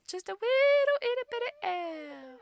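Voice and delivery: put-on high squeaky baby talk; cutesy high voice. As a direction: coo it like a tiny elf. Cooing over a tiny elf.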